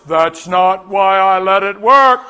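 slow, loud, drawn-out pronouncement; put-on heavenly voice, slow and loud; mock voice from above. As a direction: boom it out like a disapproving God. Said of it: "And a voice".

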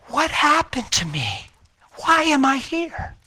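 high, bewildered questions; confused wife. Retold or imitated. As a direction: ask as if just waking. Wife confused after coma.

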